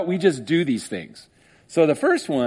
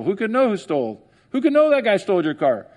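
higher mock shrug; mock court skeptic; 5 semitones higher than usual. Preacher mocks the court dismissing a theft, higher. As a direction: play it dismissive. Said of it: "you come into court, like".

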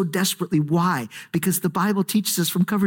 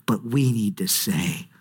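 ordinary delivery

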